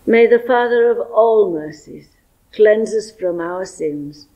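normal talking voice

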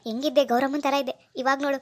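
usual speaking voice

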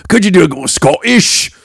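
high, loud mock Scottish; attempted Scottish accent. Host asks guest for another accent, mock Scottish. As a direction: put on an exaggerated Scottish accent.

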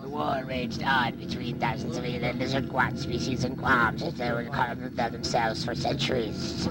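ordinary delivery, nothing marked

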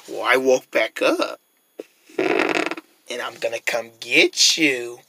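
low, soft, slow threat; menacing pig. Acted. Pig back from the spell threatening rabbit, menacing. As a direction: drawl it low and quiet.

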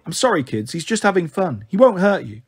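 usual speaking voice

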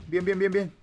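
normal talking voice

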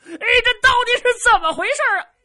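high, loud, fast confused bumpkin voice; bewildered simpleton. Acted. Zhu Can asks the soldiers, flustered high shout. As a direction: demand answers in a flustered rush.

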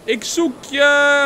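drawn-out, high searching call. Acted. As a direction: shout loudly into the woods for a lost friend. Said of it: "mijn vriend roepen of zo".

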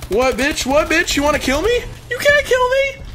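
very high, excited trash talk; taunting squeal. Real, not acted. Gamer daring enemy to kill him, taunting squeal. Trash-talking opponents in game.

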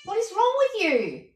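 high, slow voice for the dog; talking to the dog. Real, not acted. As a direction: coo at the dog in a sing-song.

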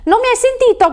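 very high-pitched, indignant narrated cry; furious cry. A narrator's reading. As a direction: demand it angrily, pitch soaring. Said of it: "gridò infuriato". (grido acuto, infuriato)

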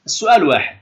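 usual speaking voice

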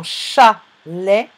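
"chalet" broken in two, CHA... LET; syllable by syllable. Shown as a demonstration. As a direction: say "chalet" slowly, pausing between syllables. Teacher splits word into syllables. (« chalet » découpé en syllabes)

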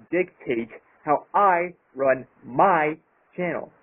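slow, with I and my each leaned on hard; stress on I and my. Real, not acted. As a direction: hit I and my firmly, slowly. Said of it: "Emphasis on the words I and my".

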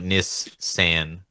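Nissan split slowly into "Niss ... san"; slow, syllable-by-syllable "NISS-SAN"; syllabalized. Shown as a demonstration. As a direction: draw out the double s in Nissan, then "san". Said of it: "syllabalize it".

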